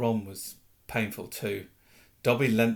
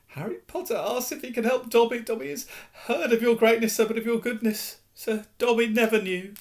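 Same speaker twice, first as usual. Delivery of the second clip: high, louder adoring elf voice; grateful house elf; 10 semitones higher, 3 dB louder than usual. Acted. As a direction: worship your hero. Dobby overwhelmed with gratitude.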